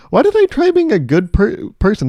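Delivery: high, exasperated mock outburst; mock exasperation. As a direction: protest it high, as if utterly fed up. Acted regret at being good.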